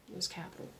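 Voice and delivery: soft unvoiced whisper. Shown as a demonstration. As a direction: breathe it out in a whisper. Repeats title two ways.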